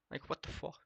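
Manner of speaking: unvoiced, breathy disbelief; exasperated whisper; whispered exasperation. Real, not acted. Exasperated aside.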